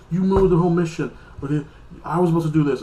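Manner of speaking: a fairly loud mock tirade from the ex-husband; angry blame. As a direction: snap at him for ruining the mission. Ex-husband blames Lucifer angrily.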